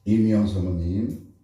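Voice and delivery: normal talking voice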